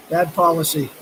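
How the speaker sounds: ordinary talk, nothing marked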